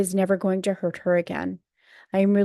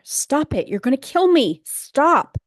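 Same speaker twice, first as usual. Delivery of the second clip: high, loud panicked pleading, retold; imitating a frightened woman screaming, higher and louder; imitating terrified cries; 7 semitones higher, 6 dB louder, slower than usual. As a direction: voice the mother's desperate cries for him to stop. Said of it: "we hear distressed cries from my mother".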